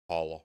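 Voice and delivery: Holla with a broad ah; other pronunciation. Shown as a demonstration. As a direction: repeat Holla as "HAH-luh", low. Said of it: "You say holla, don't you?".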